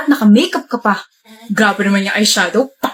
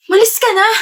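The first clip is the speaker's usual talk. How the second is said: angry shout; 9 semitones higher than usual. Acted. Angry girlfriend sending him away.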